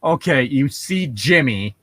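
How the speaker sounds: ordinary delivery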